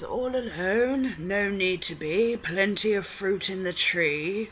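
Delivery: sing-song come-on from a lecherous aristocrat; oily, drawn-out sleazy lord; creepy Lord Boothby. Retold or imitated. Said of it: "I have to do his voice".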